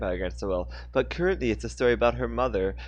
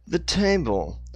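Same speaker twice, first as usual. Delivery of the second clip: slowly; 8 semitones higher, 3 dB louder, slower than usual. Acted. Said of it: "he said slowly".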